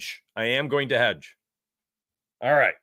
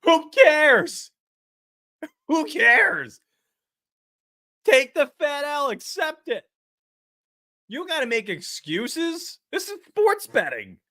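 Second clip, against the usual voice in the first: mocking voice; 12 semitones higher, slower than usual. Mocking excuse-making bettors.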